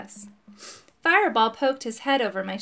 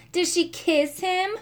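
hooting taunt; 8 semitones higher than usual. A narrator's reading. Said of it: "hooted Thomas".

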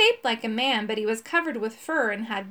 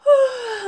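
big, high, breathy yawn; acted yawn; 13 semitones higher, 8 dB louder than usual. A narrator's reading. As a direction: yawn out a long puff of air. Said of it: "He yawned".

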